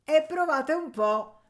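high, loud, drawn-out dare; cocky. A narrator's reading. Boy daring the miller to kick him, cocky. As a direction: defy him boldly, taking it slowly. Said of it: "disse egli spavaldo".

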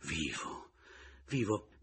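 lost, as if half out of his mind; half delirious. A narrator's reading. Pomino, stunned to see Mattia alive, half raving. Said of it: "quasi vaneggiando".

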